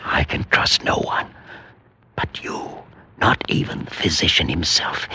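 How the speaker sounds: soft unvoiced whisper; hushed conspiratorial whisper. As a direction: confide it so no one overhears. Confides in secret.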